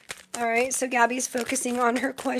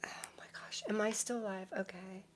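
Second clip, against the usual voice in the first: whisper; 2 semitones lower, 16 dB quieter than usual. Real, not acted. Checking quietly if stream still live.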